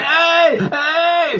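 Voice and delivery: calling out. Retold or imitated. Patient in the ER calling for the nurse, high strained call. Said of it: "and you're like".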